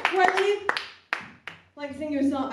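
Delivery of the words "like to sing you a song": soft shy voice. Pretend. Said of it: "I'd actually just kinda really".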